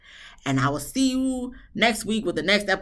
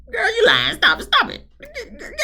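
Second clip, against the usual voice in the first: much higher, laughing and louder; laughing disbelief; 4 dB louder than usual. Real, not acted. Recapper laughing off Suki's claim. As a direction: cackle it out in disbelief.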